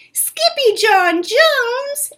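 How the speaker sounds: high, loud and very slow call; high drawn-out shocked call; cried name. Acted. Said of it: "everyone cried".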